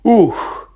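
high voiced "ugh" falling away; sudden exhaled sigh; sigh of relief. A narrator's reading. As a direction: let out a big sighed "Ух". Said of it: "зітхнув Гегрід".

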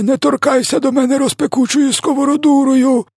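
extremely high, wailing plea for mercy; yelling. A narrator's reading. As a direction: beg frantically in a sustained, hammed-up squeal. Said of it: "репетував тілогриз". (відчайдушне благання тонким криком)

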